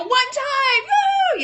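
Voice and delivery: high, loud celebratory whoop; excited cheer. Retold or imitated. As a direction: cheer like he won.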